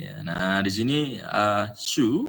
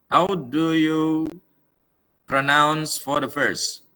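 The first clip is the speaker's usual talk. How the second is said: slow question; 5 semitones higher, 4 dB louder, much slower than usual. Shown as a demonstration. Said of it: "teacher asks slowly".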